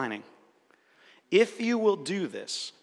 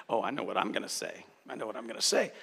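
low, soft self-satisfied mutter, much quieter; smug inner voice; 7 semitones lower, 8 dB quieter, faster than usual. Retold or imitated. Scene: mocking a listener planning their reply, quiet smug murmur. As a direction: voice the listener who has stopped listening. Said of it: "If you catch yourself formulating".

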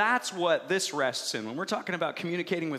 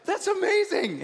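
high, louder burst of praise; delighted parent; 13 semitones higher, 5 dB louder than usual. Retold or imitated. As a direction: marvel at the child aloud. Parents praising toddler's wrong word.

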